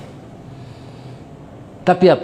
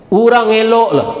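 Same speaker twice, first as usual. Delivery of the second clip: high put-on voice; mock character voice; 8 semitones higher than usual. Preacher voicing a villager's remark in a high put-on voice. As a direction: toss it off in a character's voice.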